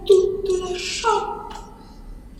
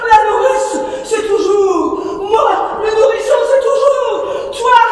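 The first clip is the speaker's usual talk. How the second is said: shouted refrain; 5 semitones higher than usual. Acted. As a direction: fling the refrain at her. Refrain crié, repris plus bas. (refrain crié)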